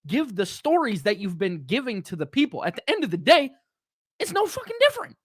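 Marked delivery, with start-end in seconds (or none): 4.2-5.2 frustrated outburst. Real, not acted. Heated rant peak.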